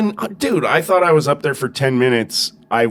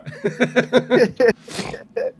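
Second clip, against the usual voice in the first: high, quick burst of laughter; laughing; 12 semitones higher than usual. Real, not acted. Laughs after the joke.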